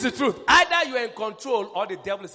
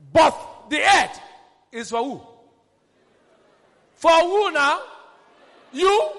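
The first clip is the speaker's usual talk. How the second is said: preaching shout; 8 semitones higher, 9 dB louder, slower than usual. Real, not acted. Preacher pressing the congregation.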